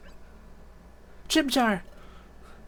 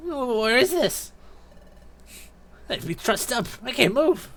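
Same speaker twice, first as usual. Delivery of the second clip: high-pitched bewildered prisoner voice; tied-up captive; 10 semitones higher than usual. Acted. Captive trussed up and unable to move, high bewildered voice. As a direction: play a helpless captive, pitched up.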